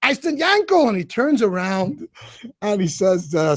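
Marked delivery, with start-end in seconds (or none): 0.3-0.9 high, raised call of the name. Retold or imitated. Calling to Yankel across the graves, raised voice. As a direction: call him again, still amazed. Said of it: "Stop screaming".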